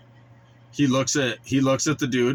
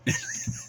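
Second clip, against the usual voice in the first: mocking laugh; 8 dB quieter than usual. Reaction to the predator's tantrum.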